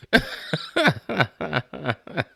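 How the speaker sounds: long, real fit of laughter in short bursts. Real, not acted. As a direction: crack up helplessly at your own story. Cracks up over the foul remark.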